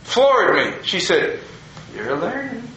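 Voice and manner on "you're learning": retold softly, voice lifted; quiet knowing reply. Elderly woman answers the pastor's thanks, quiet and knowing. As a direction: say it small and soft, higher in pitch.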